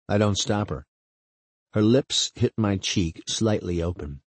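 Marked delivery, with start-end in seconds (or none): none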